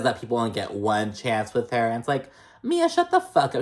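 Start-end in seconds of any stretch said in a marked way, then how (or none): 2.6-3.6 high, sassy mock snap; sassy mock retort. Vlogger voicing a cast member telling Mia off, high sassy mock. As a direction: toss it off like a catty comeback.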